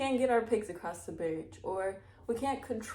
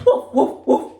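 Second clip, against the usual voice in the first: loud laughing woof woof; playful barking; 3 semitones higher, 13 dB louder than usual. A narrator's reading. Reader voicing the dog for her audience, playful bark.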